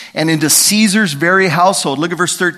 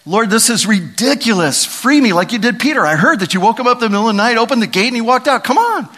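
mock complaint; 7 semitones higher, 3 dB louder than usual. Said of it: "Paul could have sat there and said".

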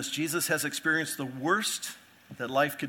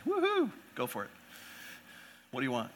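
mock eager cheer; 2 semitones lower, 7 dB quieter, faster than usual. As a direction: cheer it on, then shrug. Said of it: "we'd all say".

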